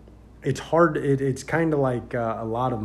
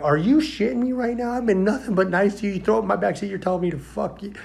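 much higher, louder furious rant; yelling back; 10 semitones higher, 6 dB louder than usual. Retold or imitated. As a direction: give it right back to him. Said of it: "I started giving it right back to him".

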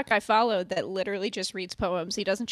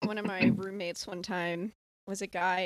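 ordinary talk

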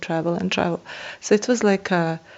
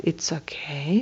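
much softer, low and soothing; calming voice; 3 semitones lower, 9 dB quieter than usual. Retold or imitated. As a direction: soothe her gently like settling a storm. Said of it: "in with his calming voice".

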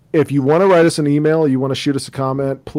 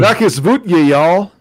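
higher, louder mock-Texan Russian greeting; Texas-accented Russian; 5 semitones higher, 6 dB louder, much slower than usual. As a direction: ham up a Texan drawl on the Russian. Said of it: "speaks Russian with a Texas accent".

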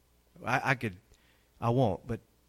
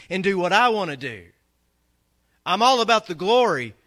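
high, louder mock-pious voice; mocking quote; 9 semitones higher, 8 dB louder than usual. Preacher imitating fair-weather believers, mock-pious sing-song. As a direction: parody a shallow believer. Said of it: "How many people say".

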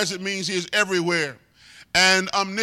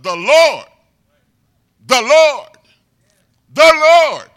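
building shout; 6 semitones higher, 12 dB louder than usual. Acted. Preacher builds the repeated phrase.

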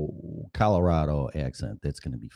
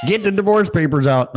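mock command; 10 semitones higher, 8 dB louder than usual. Jokey mock demand.

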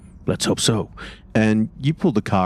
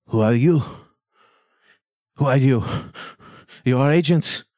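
voice behind the door; 6 semitones higher than usual. Acted.

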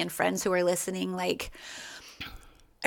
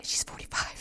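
whispered aside; 15 dB quieter than usual. Retold or imitated. Whispered aside correcting age.

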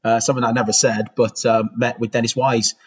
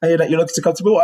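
higher mock chairman, quick at the end; Theo imitated; 7 semitones higher than usual. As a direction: play the chairman, pitched up and brisk. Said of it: "He was".

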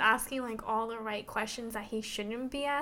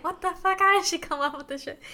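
higher and louder, hammed-up disbelief; mock baffled outburst; 7 semitones higher, 7 dB louder, faster than usual. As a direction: blurt it out stunned. Retold baffled Zane.

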